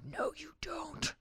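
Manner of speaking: put-on slow secretive whisper to a child. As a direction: hiss it quietly so no one hears. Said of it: "And I'd be like".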